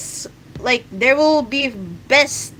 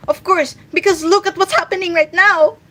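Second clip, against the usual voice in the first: heated rant; 7 semitones higher, 5 dB louder than usual. Real, not acted. Ranting about the country's government.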